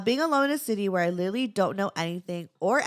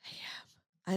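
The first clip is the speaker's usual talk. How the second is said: whispered admission; 12 dB quieter than usual. Real, not acted. Quiet admission of being homebody.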